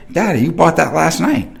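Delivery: put-on high, small child's voice; little son. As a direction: play the toddler answering innocently. Voicing his young son.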